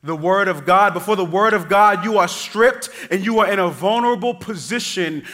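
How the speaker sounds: loud, high rising preaching; full-force sermon close. Acted. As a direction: build the climax over the congregation.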